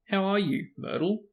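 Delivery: high, forced cheerful greeting; a fake-sunny hello to an upset ghost; falsely bright. A narrator's reading. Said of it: "in a falsely bright voice".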